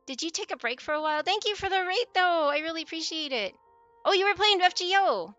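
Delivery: chirpy sweet voice welcoming raiders; cute anime streamer. Real, not acted. Greeting raiders high and loud.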